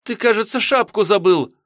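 put-on very high, loud girl's call; calling out loudly. Girl calls departing Misha back for his hat, loud cry. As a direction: shout after him from a distance. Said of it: "громко закричала девчонка".

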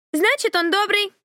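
put-on high little child voice; very high small boy voice. A narrator's reading. Child answers from the room.

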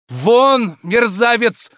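high, loud order trembling with rage; furious shout. Acted. Oblomov orders Tarantyev out, furious shout. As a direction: throw him out at the top of the voice. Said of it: "закричал Обломов".

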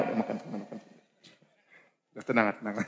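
soothing coax. Pretend. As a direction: gently hush and coax her to eat. Coaxing the angry wife with candy. (membujuk dengan lembut)

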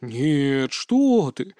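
drawn-out, pitch swooping up on the denial; exaggeratedly slow, seething denial; slow, each word stressed. A narrator's reading. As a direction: hammer out every word separately. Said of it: "медленно, выделяя каждое слово, отчеканил".

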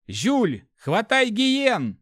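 high, loud, stretched call; urgent shout. A narrator's reading. Hero to companion, saving the animals, urgent shout. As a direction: yell the order across danger. Urgent command during spider attack.

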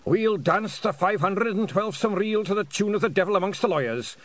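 high, clear bard with rolled R's; drum-roll R's. A narrator's reading. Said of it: "sounding his R's like a drum roll".

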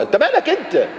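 high exasperated scold; indignant rebuke. Acted. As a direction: tell him off. Rebuking nosy questioner.

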